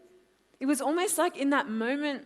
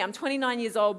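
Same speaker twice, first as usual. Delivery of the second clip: usual speaking voice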